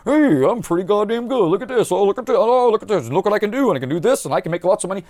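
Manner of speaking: lightly voiced show-off admiring himself in the mirror; a hint of a self-satisfied show-off; mock vain boasting. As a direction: preen like a smug braggart, half-joking.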